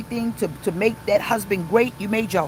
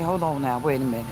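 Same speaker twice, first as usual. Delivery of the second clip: calming self-talk; 7 semitones lower than usual. Retold or imitated. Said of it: "I'm smart enough to say".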